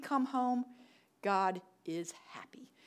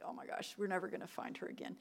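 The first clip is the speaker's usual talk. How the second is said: hushed despair; 3 semitones lower, 10 dB quieter, faster than usual. Retold or imitated. Devastated, dog lost.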